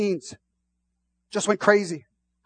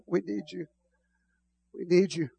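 quiet; 8 dB quieter than usual. Real, not acted. Hushed prayer.

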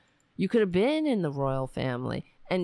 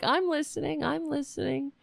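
mock listener; 9 semitones higher than usual. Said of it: "people are like".

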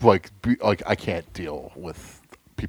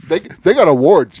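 high, much louder rant; angry rant; 8 semitones higher, 12 dB louder than usual. Real, not acted. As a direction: shout the point home.

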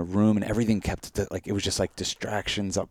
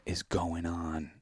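whisper; 10 dB quieter, much slower than usual. Retold or imitated. Whispered retelling of dream shock.

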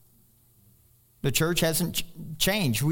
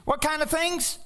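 higher, slow, half-sung preaching cadence; 8 semitones higher, slower than usual. Acted. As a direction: lift the psalm like a call to praise. Preaching the psalm at full force.

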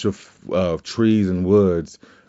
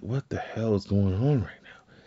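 confused mutter; 8 dB quieter than usual. Retold or imitated. Confused inmate thinking aloud.

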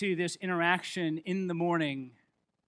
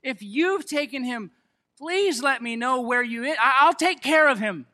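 desperate Mary; 4 semitones higher, 7 dB louder than usual. Retold or imitated. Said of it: "And she goes".

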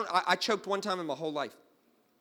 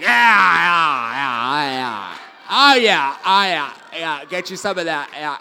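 mock ecstatic yeahs; 2 semitones lower, 14 dB louder, slower than usual. Choking man cheering on the stranger's fingers, loud mock ecstasy. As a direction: ham up the bliss of being saved from choking. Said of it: "I was like".

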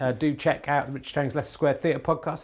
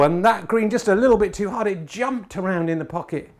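brisk, raised snooker commentator voice; live sports commentary; 5 semitones higher, 7 dB louder than usual. Acted. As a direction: call the frame live like TV commentary.

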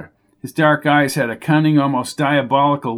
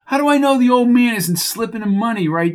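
higher, rising exasperated demand; angry outburst; 9 semitones higher than usual. Acted. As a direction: snap at them to fetch Shorty. Coco angry about jockey.

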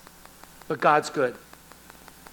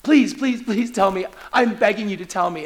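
acted desperate plea, rushed question then insistent begging; pressing, pleading urgency; urgent begging. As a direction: beg him urgently for the answer. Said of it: "so there's this urgency".

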